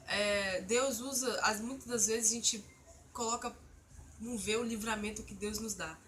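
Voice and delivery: ordinary delivery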